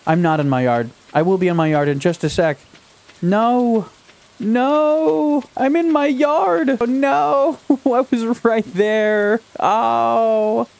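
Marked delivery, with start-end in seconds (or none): 3.2-10.7 high, drawn-out cry of defeat; stretched-out, high-pitched lament; anguished wail. Real, not acted. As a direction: wail in despair, so close to home. Collapses just short of getting home.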